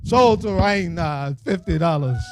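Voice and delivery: slow, drawn-out auction call; auctioneer call. Acted. Auctioneer closing the bid on fifty dollars, slow and drawn out. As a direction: drag out going once, going twice, sold.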